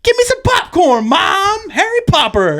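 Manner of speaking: very high, demanding kid impression; little kid. Little kid demanding popcorn from Mom, imitated kid voice. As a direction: imitate a child hollering for Mom. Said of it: "He follows it up with".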